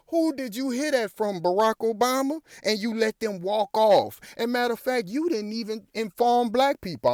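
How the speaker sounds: high, pressing challenge straight at him; indignant call-out. Real, not acted. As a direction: demand an answer, voice climbing. Confronting Obama directly, rhetorical call-out.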